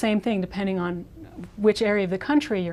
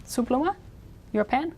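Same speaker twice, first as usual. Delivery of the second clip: "Su pluma" and "pen" lifted at the end as questions; short casual question, rising on "pluma" and "pen"; asking; 3 semitones higher, 6 dB quieter than usual. Shown as a demonstration. Asking somebody if that pen is theirs, rising "Su pluma?". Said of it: "if you just wanted to ask somebody".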